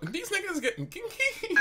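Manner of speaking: very high voice squeezed by laughter; laughing remark. Real, not acted. Storyteller reacting to his friends mid-story, high and laughing. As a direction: squeal it through a laugh.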